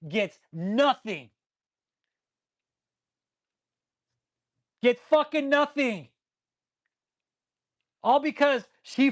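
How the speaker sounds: venting real fury, voice pushed up high; angry rant. Real, not acted. Streamer rants at viewers about a greedy pastor, furious. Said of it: "Little fucking rant over".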